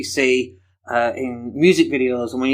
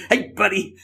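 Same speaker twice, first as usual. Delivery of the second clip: put-on, higher American greeting; American accent; 5 semitones higher than usual. Man shows how Americans address everyone, put-on American accent. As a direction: do a jokey American "buddy". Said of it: "that's my American accent".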